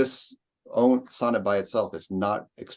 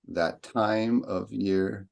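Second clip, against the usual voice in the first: each beat hammered out slowly; exaggerated meter; much slower than usual. Shown as a demonstration. Teacher drilling the class on the meter, slow exaggerated beats. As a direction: overstress the iambs to show the meter. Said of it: "I'm exaggerating here".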